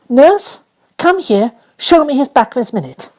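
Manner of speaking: high, loud command; bossy little girl; imperious order. Acted. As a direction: order the servant sharply. Mary ordering the nurse.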